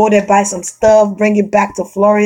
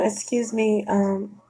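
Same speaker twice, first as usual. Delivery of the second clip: polite soft approach; 7 dB quieter than usual. Retold or imitated. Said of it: "But if you're like".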